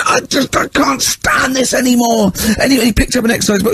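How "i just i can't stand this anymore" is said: high, slow, strained despair; exasperated teacher. Retold or imitated. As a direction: whine it out as if at the end of your rope. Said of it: "he was going mad".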